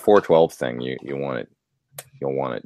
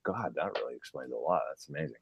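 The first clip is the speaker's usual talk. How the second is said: quiet amazement; 3 semitones lower, 16 dB quieter than usual. Retold or imitated. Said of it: "I was like".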